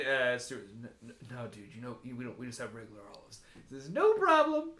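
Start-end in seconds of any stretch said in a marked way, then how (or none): 0.7-3.4 much softer, fast, stammering no; nervous bartender. Acted. 3.9-4.7 breezy billionaire. Acted. Billionaire unbothered.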